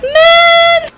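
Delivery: a high, loud, amazed cry; amazed exclamation. Retold or imitated. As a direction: gasp it out in wonder.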